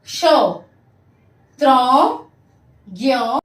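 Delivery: loud, isolated syllables with pauses; teacher modelling sounds emphatically; repeat-after-me drill. Shown as a demonstration. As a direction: call each syllable out for pupils to echo. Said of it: "आप सब मेरे पीछे पीछे बोलिए".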